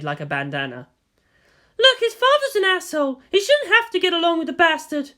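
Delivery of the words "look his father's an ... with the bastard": much higher, indignant voice; indignant guardian. Acted. Masato defending Shinji against his father, indignant and higher. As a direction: defend the boy with heat. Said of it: "Masato said".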